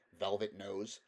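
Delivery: ordinary delivery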